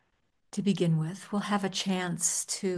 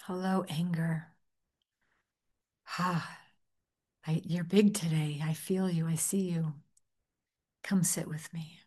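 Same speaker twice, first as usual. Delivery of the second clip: welcoming voice. Shown as a demonstration. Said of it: "in a welcoming voice".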